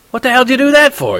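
high, loud, shocked anger; outraged. Acted. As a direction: yell at him for tearing it. Essay torn up, roommate outraged.

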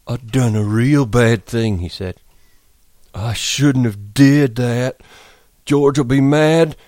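slow, loud and frightened. Acted. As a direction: realize in dread. Lennie frightened.

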